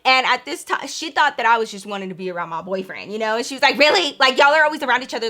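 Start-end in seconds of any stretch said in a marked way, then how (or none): 3.8-5.3 louder, higher, rushed disbelief; mock exasperated mom. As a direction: imitate a mom who isn't buying it. Acted mom, exasperated at leaving.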